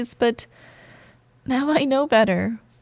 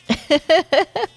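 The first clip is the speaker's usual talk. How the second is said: high, louder giggle; amused giggle; 7 semitones higher, 5 dB louder than usual. Acted. Joking to stuck coworker about body odor, amused giggle. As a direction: giggle at your own joke.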